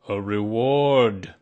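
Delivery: slow, booming repeat from Owl; very loud. A narrator's reading. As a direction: say it very loudly, drawing it out. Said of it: "said Owl very loudly".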